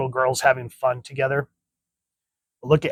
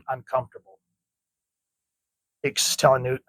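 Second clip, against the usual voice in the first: ordinary talk, nothing marked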